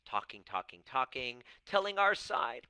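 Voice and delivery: normal talking voice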